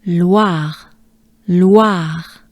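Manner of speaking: Loire as "lwa" plus breathed r; drawn-out Loire with a wa glide; French Loire. Shown as a demonstration. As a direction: model Loire slowly, throat r at the end. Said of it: "we are looking for wa".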